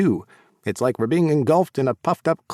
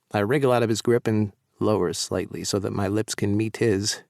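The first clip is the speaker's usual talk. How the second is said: ordinary delivery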